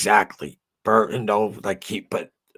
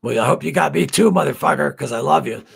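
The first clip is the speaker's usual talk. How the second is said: higher, louder teasing affection; playful shout; 8 semitones higher, 8 dB louder than usual. Real, not acted. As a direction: yell it with a grin at a friend. Affectionate outburst at Vaz.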